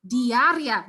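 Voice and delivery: "Diarrhea" with the long stressed I, dee-ah-REE-ah; "Diarrhea" said slowly, leaning on the I. Shown as a demonstration. Teacher models "Diarrhea" for students, long stressed I. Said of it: "the stress has to go right here, the long sound".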